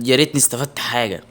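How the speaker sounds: normal talking voice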